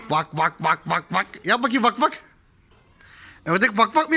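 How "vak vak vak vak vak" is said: even string of quacks; duck quack imitation. Shown as a demonstration. Showing little Güneş what a duck says, imitated quacking. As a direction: quack like a duck, evenly spaced.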